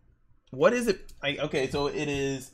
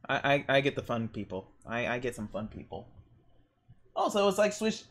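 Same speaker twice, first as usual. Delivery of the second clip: ordinary talk, nothing marked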